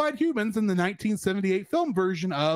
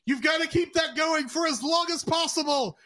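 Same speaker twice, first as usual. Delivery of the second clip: mock order; 8 semitones higher than usual. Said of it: "It's like".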